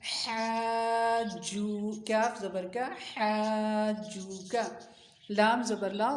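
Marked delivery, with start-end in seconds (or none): 0.0-1.7 हाजू held very long with a rise; drawn-out word. Shown as a demonstration. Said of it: "joining syllables demo". 3.1-4.6 हाजू का stretched slow, rising; drawn-out blend. Shown as a demonstration. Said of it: "joining syllables demo".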